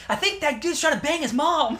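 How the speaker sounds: high-pitched mock stoned voice; drugged bewilderment. Acting kids on truth serum watching a movie, bewildered. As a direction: puzzle it out as if drugged and giggly. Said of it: "they're high on the truth serum".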